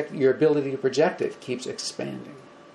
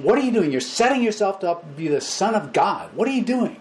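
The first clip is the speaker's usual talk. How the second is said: mock accusing Pharisees; 6 semitones higher than usual. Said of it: "they are saying".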